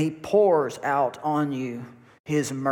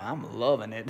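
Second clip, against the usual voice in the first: content; 5 semitones lower, 6 dB quieter than usual. Retold or imitated. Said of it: "And I'm like".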